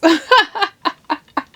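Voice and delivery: high-pitched burst of laughter; real laugh. Real, not acted. Laughing at dreams not paying the bills, real high laugh. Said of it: "I'm laughing".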